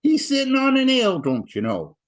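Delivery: imitated Ottawa Valley farmer, jumping high and loud; Ottawa Valley accent. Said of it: "got the Ottawa Valley accent".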